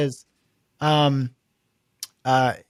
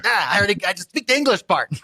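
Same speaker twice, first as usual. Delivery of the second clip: higher, annoyed mock caller; exasperated caller; 6 semitones higher than usual. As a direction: sound fed up with the phone menu. Frustrated caller.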